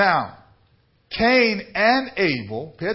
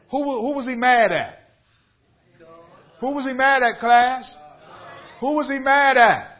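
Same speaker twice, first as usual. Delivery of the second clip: high, loud, calling out to the congregation; preaching at full force; 9 semitones higher, 4 dB louder than usual. Acted. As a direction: drive the question home like a pulpit preacher.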